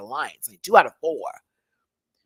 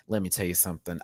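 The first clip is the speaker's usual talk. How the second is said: ordinary talk, nothing marked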